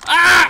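high, loud short scream; sudden scream. Real, not acted. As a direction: shriek out suddenly.

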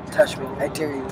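ordinary talk, nothing marked

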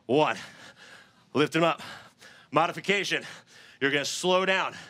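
ordinary talk

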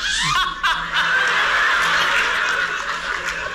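high breathless cackle; a helpless airy burst of laughter. Real, not acted. Storyteller cracking up at his own story, a breathless high laugh.

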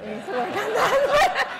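amused repetition of the joke; laughing repeat. Real, not acted. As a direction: repeat the line through a laugh. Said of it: "so we need to work on that".

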